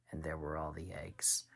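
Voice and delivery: hushed storytelling whisper. A narrator's reading. Dad whispering the eggs scene to his child. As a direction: breathe it to build suspense.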